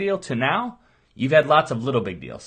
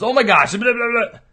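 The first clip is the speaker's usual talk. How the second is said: mock freak out; 8 semitones higher, 5 dB louder than usual. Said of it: "and it's".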